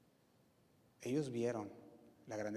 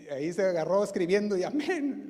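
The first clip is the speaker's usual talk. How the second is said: laughing; 6 semitones higher, 9 dB louder than usual. Real, not acted. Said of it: "preacher laughs at own joke".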